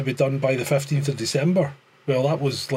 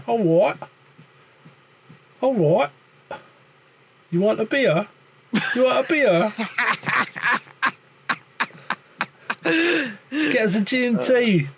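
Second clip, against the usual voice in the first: put-on southern English accent; 8 semitones higher than usual. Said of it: "I could put the accent on".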